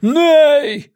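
high, loud wail of protest; howling cry. A narrator's reading. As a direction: howl it out in dismay. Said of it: "взвыл второй".

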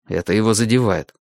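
ordinary delivery

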